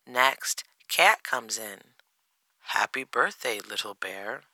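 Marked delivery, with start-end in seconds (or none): 2.8-4.4 lightly voiced cat, low-key greeting; understated cat's greeting, falling away. A narrator's reading. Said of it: "he says".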